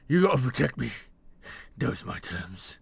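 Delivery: loud, high, urgent bargaining; desperate demand. Acted. Wounded captive demanding protection before he talks, desperate shout. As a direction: shout the terms like your life hangs on them.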